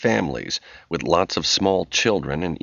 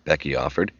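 ordinary delivery, nothing marked

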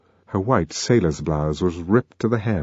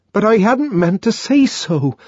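higher, anguished protest; crying out in agony; 12 semitones higher, 3 dB louder than usual. A narrator's reading. John protesting to Lenina in anguish. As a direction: cry it out helplessly. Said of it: "cried the savage".

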